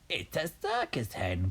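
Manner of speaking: high put-on Grandma voice; rooftop shout. A narrator's reading. Grandma calling from the rooftop, high mock shout. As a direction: call down as an old granny, pitched high. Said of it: "Grandma shouted from the rooftop".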